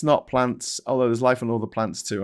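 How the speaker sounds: ordinary talk, nothing marked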